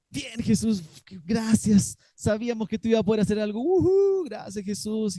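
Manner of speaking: hammed-up cheerful relief; cheerful thanks. Disciples pretending to thank Jesus, breezy and excited. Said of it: "la reacción de los discípulos no es".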